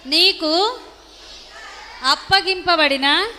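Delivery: slow, each phrase ending on a rise; feed the verse phrase by phrase for repetition; slow recitation for repeating. Shown as a demonstration. Leads children in verse repetition. (నెమ్మదిగా, పిల్లలతో వల్లె వేయిస్తూ)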